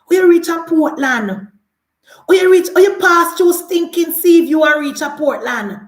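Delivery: loud, scornful call-out; outraged challenge. Acted. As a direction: fire it at him loudly, in indignation. Mock outrage at the politician.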